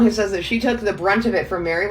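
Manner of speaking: ordinary talk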